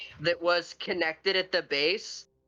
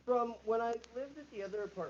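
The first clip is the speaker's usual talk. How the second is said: muffled from another room; 4 semitones higher, 9 dB quieter than usual. Real, not acted. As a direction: voice muffled, far from the mic. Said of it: "You're muffled again".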